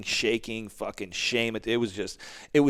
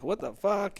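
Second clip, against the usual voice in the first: much higher, stunned what the fuck; shocked wife; 11 semitones higher than usual. Retold or imitated. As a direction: squeal it in disbelief as the wife. Wife sees his six pack.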